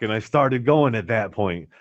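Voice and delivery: ordinary delivery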